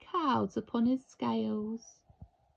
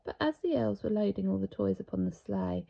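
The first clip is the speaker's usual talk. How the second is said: ordinary delivery, nothing marked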